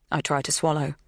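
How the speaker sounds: usual speaking voice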